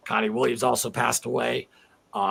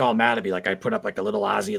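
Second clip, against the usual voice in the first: ordinary talk, nothing marked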